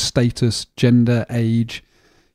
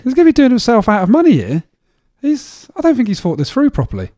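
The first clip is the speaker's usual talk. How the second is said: incredulous; 11 semitones higher, 4 dB louder than usual. Real, not acted. Animated disbelief.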